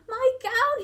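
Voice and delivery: high, loud happy yell. A narrator's reading. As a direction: shout it out in delight at finding it. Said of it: "he yelled".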